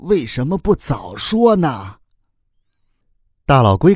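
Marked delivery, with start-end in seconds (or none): 0.1-2.0 mild, high-pitched exclaiming question; hurried reproach. A narrator's reading. Turtle learning who the mother is, mildly reproachful. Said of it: "赶忙说".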